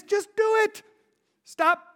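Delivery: preacher urging the congregation to act; emphatic preaching. Real, not acted. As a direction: drive the command home. Pastor preaching at full force.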